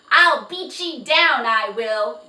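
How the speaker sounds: high, very loud threatening yell; angry yell. Acted. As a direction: bellow the threat at the horse. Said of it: "yelled Jiggy".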